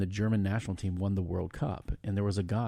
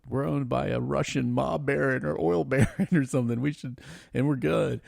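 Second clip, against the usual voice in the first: friend's voice; 5 semitones higher than usual. Retold or imitated. Chelsea friend's email voiced.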